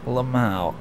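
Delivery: ordinary delivery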